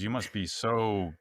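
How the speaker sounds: slowly drawn-out teasing remark; lightly imitating a wry woman; slow deadpan quote. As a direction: drag out the punchline dryly. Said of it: "She goes".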